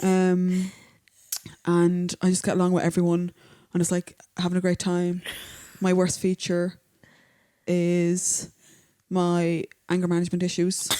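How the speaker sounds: impression of her friend. Imitating her co-host on a podcast in a deep voice. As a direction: play the friend with a low, steady voice. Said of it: "you have a real deep voice".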